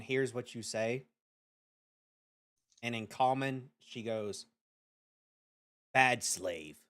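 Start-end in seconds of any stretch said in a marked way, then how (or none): 5.9-6.8 low, louder demon mother; a low scolding demon. Acted.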